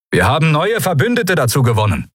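urgent commander's message; radio announcement. A narrator's reading. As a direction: announce it to the whole fleet.

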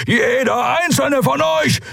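a slow, booming roar of rage; a caged giant roaring threats at the captors; thundering Keru. A narrator's reading. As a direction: bellow it out slowly and deeply. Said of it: "donnerte Keru".

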